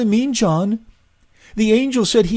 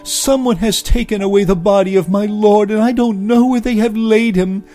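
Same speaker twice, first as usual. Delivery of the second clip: high, loud, tearful plea; distressed pleading; 10 semitones higher, 6 dB louder than usual. A narrator's reading. Mary begging the men at the tomb, high and distraught. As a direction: beg for help on the edge of tears.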